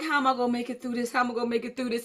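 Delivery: soft, fast worried mumble; quietly psyching yourself up in the shower; dreading aloud. Retold or imitated. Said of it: "I got in the shower like".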